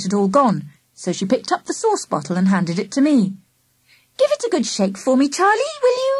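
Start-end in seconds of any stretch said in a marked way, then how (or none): 4.2-6.1 sugary high pleading; begging. A narrator's reading. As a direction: wheedle it sweetly, rising high. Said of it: "she begged".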